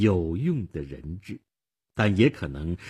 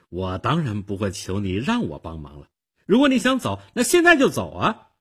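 washing her hands; 2 semitones higher, 7 dB louder than usual. A narrator's reading. Girl tells Nick to leave, washing her hands of him. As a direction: build to a sharp dismissal. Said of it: "少女一副撒手不管的样子".

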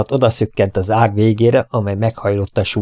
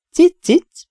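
very high sweet wheedle on "Cic, cic"; coaxing cat call; 3 dB louder than usual. A narrator's reading. Vet up the tree coaxing the cat, very high and sweet. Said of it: "hízelegtem ellenállhatatlan macska hívó hangomon".

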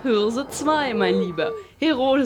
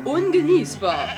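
usual speaking voice

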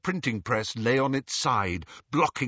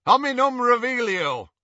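loud, high incantation; casting a spell; 11 semitones higher, 6 dB louder than usual. A narrator's reading. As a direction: call out the spell forcefully. Wizard calls out a spell.

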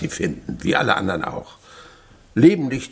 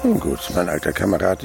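normal talking voice

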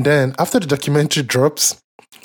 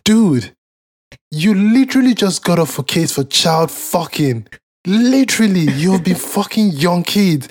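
exasperated rant; 5 semitones higher than usual. Real, not acted. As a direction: really let the outrage build.

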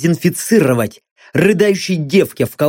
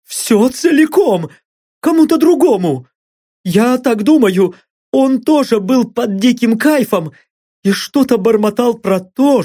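high, shaky pleading captive; frightened blond; 5 semitones higher than usual. Acted. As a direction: talk fast to save your skin. Blond's voiced answers.

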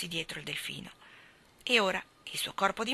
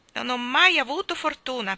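mildly hammed-up lament, higher and louder; tearful wail; 5 semitones higher, 7 dB louder than usual. A narrator's reading. Park keeper bemoaning his bad luck, tearful wail. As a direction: complain as if close to tears. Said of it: "piangeva il guardiano".

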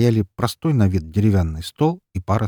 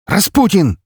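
резко, высоко и громко; окликни криком; выкрик; 13 semitones higher, 9 dB louder, slower than usual. Acted. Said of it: "выкрикнул Лазарев". (Лазарев выкрикивает имя)

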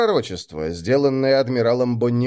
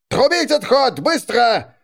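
higher, louder command; barked order; 10 semitones higher, 3 dB louder, slower than usual. Acted. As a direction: bark the retreat order. Officer orders retreat.

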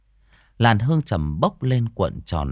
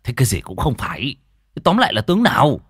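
exasperated demand, rising to a higher, louder finish; angry; 4 semitones higher, 4 dB louder than usual. A narrator's reading. Vương Phú demands the riddle's answer, exasperated. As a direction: lose patience and demand the answer. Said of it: "Vương Phú tức giận".

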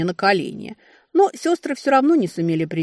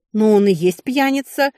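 higher, louder innocent protest; naive drawl; 4 semitones higher, 6 dB louder than usual. A narrator's reading. Younger sister defends calling father-in-law drunkard, naive. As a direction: insist on it in all innocence. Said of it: "протянула святая наивность".

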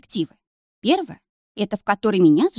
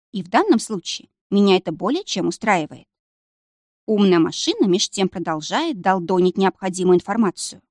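ordinary delivery

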